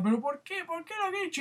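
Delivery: high, pleading wife's cry; hammed-up distraught wife; mock distressed wife. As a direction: beg to know why. Wife crying, retold.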